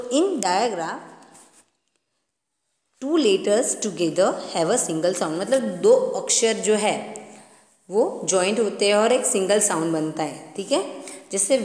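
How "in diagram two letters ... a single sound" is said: slow reading aloud. Shown as a demonstration. Teacher to children reading the textbook definition, slowly. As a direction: read the definition word by word, very slowly.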